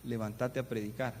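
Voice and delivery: low, soft voice from within; hushed, low-pitched command; inner voice. Retold or imitated. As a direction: deliver a quiet, low inner summons. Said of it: "Sentí una voz que me dijo".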